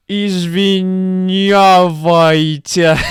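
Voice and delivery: put-on young man mumbling his apology; drawn-out apology. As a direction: stretch the word out like a sheepish youth. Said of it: "that's what he said".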